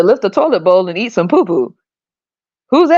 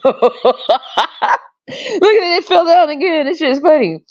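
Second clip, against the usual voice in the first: high, laughing through the words; 6 semitones higher, slower than usual. Real, not acted. Speaker rewatching the chocolate scene, laughing hard. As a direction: squeal it while cracking up.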